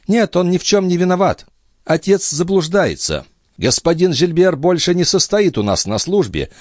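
high-pitched female character voice; calm, authoritative high voice; composed young noblewoman. A narrator's reading. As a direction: speak for the sister in a high, firm voice. Narrator voices Andrea defending Gilbert.

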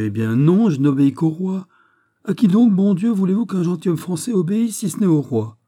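loud, high, indignant outburst; indignant protest. A narrator's reading. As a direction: burst out in defiant protest. Governor acted as protesting defiantly.

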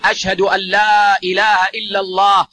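full stretched call, carried far. Shown as a demonstration. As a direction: raise and draw out the voice as in the adhan. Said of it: "يمد بها صوته".

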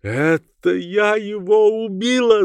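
high, drawn-out hysterical wail; slow, high-pitched wail; wild cry. A narrator's reading. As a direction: scream a horrified confession. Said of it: "закричала она".

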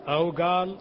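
normal talking voice

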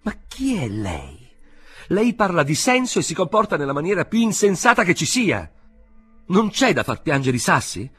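high, loud indignant protest; rising anger, almost shouting; indignant outburst. A narrator's reading. K protesting to the inspector, high indignant outburst. K protests his arrest angrily.

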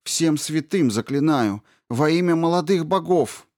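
high, loud imploring plea; fervent high-pitched entreaty; desperate pleading. A narrator's reading. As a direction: beg with everything you have. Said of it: "взмолилась принцесса".

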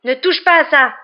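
high, loud angry shout; furious shouted order; roaring. A narrator's reading. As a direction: roar a warning. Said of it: "rugit Harry".

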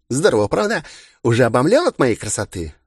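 put-on high, bubbly, self-adoring girl; giddy party girl. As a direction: show off like a pageant queen. Староста представляется, хвастаясь. (игривая самовлюблённая девчонка)

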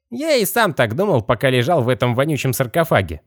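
high, otherworldly skeleton voice. Acted. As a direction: pitch it way up like a rattling skeleton.

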